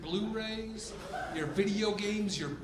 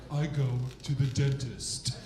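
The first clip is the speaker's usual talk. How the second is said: pretentious; 5 semitones lower than usual. Retold or imitated. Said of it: "something more pretentious like".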